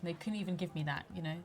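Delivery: ordinary talk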